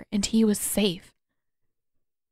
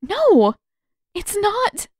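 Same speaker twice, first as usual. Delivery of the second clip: high, louder, breaking voice; tearful denial; 13 semitones higher, 6 dB louder, much slower than usual. A narrator's reading. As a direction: choke it out through tears. Katara fighting back tears.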